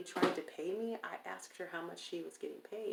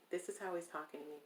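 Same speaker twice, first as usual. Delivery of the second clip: ordinary delivery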